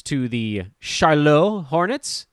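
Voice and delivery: slow, hammed-up French accent on Charlotte Hornets; terrible French accent. Welcoming the Hornets back to the States, mock French accent. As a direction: mangle it like a bad Frenchman, slowly. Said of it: "with a terrible French accent".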